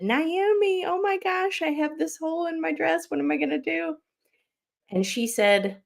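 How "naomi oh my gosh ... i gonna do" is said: high-pitched panicky plea to a friend; panicked plea. Retold or imitated. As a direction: squeak it out in a panic about the hole. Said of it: "I went up to her ... and I said".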